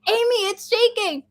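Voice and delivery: high, insistent call to a sleeper; mock urgent wake-up call; retold calling out. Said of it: "I had to call her three times".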